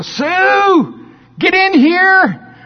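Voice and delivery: high, loud excited call to the spouse; bursting with excitement, yelling for the camera. Retold or imitated. Parent yells to spouse to bring camera, high excited shout.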